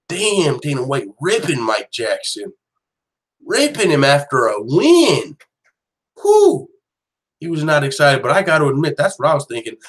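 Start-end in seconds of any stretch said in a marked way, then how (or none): 6.2-6.7 high, loud whew of disbelief; a sharp, high whew at the harsh words; whistling whew. Real, not acted. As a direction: let out an impressed whew. Reacting to harsh interview.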